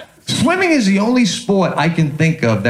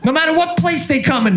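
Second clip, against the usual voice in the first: normal talking voice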